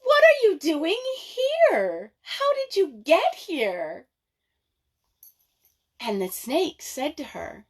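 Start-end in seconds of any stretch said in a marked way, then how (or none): 0.0-4.1 much higher, joyful surprised greeting; delighted laugh. Acted. Nyasha laughing with surprise at her friend on the throne, delighted. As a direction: greet an old friend with glee. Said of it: "she laughed and she said".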